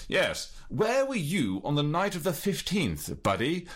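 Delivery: hammed-up British interrogator; a touch of posh British; British accent. Shown as a demonstration. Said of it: "Pip, Pip, hooray".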